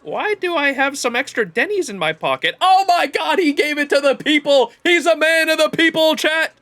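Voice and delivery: high, loud excited squeal; thrilled squeaky delight. Real, not acted. Excited at found money.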